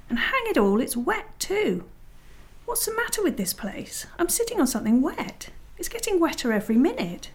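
high-pitched, indignant grumbling; exasperated complaint. Acted. Peter complains about cold and wet.

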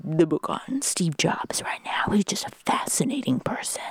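dad impression. Daughter imitating her dad on Steve Jobs, hushed whisper. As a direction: breathe it out like an earnest dad. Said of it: "this is what you sound like".

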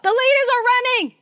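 high-pitched excited holler to another room; calling out. Retold or imitated. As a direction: call it out high and urgently. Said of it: "he'll call out".

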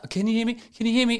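faint speakerphone lady. Retold or imitated. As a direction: imitate a woman barely heard on a bad speakerphone. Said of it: "a lady that sounds like she's on speakerphone down a cliff".

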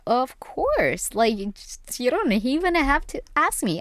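a lightly voiced, keen yes to dad; eager acceptance. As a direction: jump at the invitation. Eager reply to dad's lunch invite.

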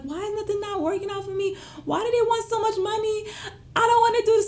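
very high-pitched tearful whine; imitated crying. Crying to her husband about the space, mock wailing. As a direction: wail it like an acted-out meltdown. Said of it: "So I'm crying in the house".

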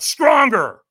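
loud high push. Retold or imitated. Trainer demanding a stronger yes. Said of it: "And he went".

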